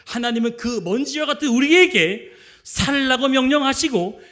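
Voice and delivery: fervent preaching. Acted. Pastor urging the congregation, fervent preaching. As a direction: press the point home to the congregation.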